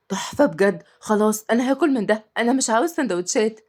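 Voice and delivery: usual speaking voice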